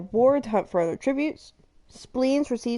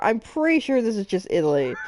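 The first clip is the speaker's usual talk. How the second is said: usual speaking voice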